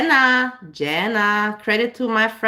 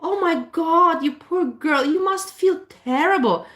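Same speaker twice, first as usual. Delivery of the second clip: higher, slow, syrupy mock sympathy; mock pitying doctor; 7 semitones higher, slower than usual. Mocking doctors who gush over a sick patient, higher sugary voice. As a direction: coo it like an overly pitying nurse. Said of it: "They are not gonna look at you and say".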